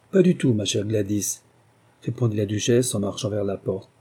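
ordinary delivery, nothing marked

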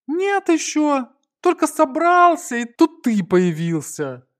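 high, loud character voice; grumbling Gonza, annoyed. A narrator's reading. Gonza to friend who interrupted his outing, loud high complaint. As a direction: complain loudly in a high, put-on voice.

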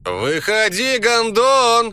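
high, loud angry yell; shouting. Retold or imitated. Borya calls the hidden voice out, loud angry yell. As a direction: bellow it out in rage. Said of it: "кричу".

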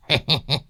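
soft little giggle; sheepish chuckle. A narrator's reading. Old master realising the ghost was a mango, sheepish chuckle. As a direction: laugh off your own false alarm. Sheepish laugh at own mistake.

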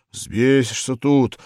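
out of breath. A narrator's reading. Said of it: "задыхаясь".

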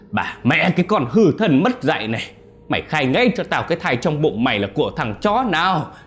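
a father roaring at his daughter, high and loud; furious yelling. A narrator's reading. Father beating his pregnant daughter, furious yelling. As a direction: scream the rage out, no holding back. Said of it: "Ông ta gào lên".

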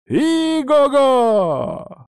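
high, drawn-out whinny; horse neigh. Acted.

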